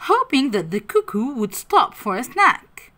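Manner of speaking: ordinary delivery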